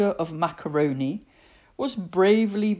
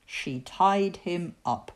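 ordinary delivery, nothing marked

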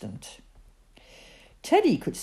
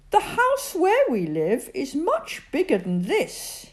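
boastful Bertie; 11 semitones higher, 3 dB louder than usual. A narrator's reading.

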